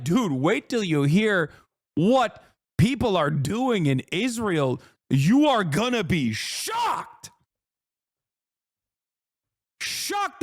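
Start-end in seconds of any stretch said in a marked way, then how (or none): 5.1-10.4 slow, drawn-out fake suspense; hammed-up mock announcement; sarcastic mock teaser. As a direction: tease the shock like a cheesy host.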